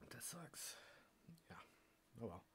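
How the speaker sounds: whispered disappointment. Real, not acted. Tasting chemical soot, whispered disappointment. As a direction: breathe it out, let down.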